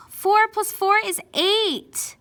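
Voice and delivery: high, loud and slow, hammering each word; shrill slow scolding correction; exasperated correction. Acted. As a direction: spell it out to a hopeless pupil. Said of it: "That's how I feel like inside when I mess up".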